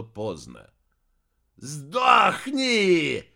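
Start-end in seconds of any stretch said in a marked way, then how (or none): 1.6-3.2 loud, raised-pitch furious roar; growling. A narrator's reading. Heir seizing the warrior by the neck, growling. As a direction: roar it with murderous rage. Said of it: "прорычал носатый".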